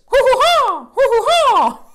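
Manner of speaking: high, loud hooting hoo hoo ha; a silly, loud whoop effect; random sound effect. Shown as a demonstration. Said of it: "random sound effects of randomness".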